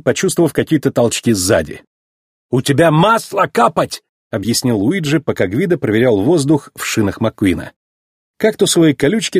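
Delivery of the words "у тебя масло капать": higher voice with a hint of Italian accent; light Italian accent. Luigi explains to the race car, light Italian accent.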